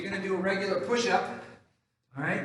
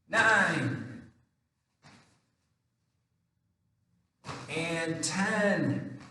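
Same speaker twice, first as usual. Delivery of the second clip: counting workout reps; much slower than usual. Shown as a demonstration. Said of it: "I'm gonna count 'em out for you guys".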